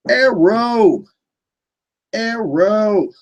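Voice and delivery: high, drawn-out put-on voice; hammed-up title call. Streamer answering favorite finale question, hammed-up title call. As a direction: belt out the title in a silly high voice.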